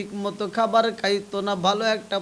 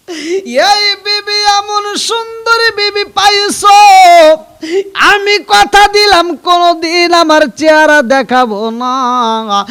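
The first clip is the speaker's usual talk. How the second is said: loud, high chanted lament; impassioned wailing sermon; 8 semitones higher, 14 dB louder than usual. Acted. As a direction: belt out the mother's vow in a high wail. Preacher cries out mother's promise.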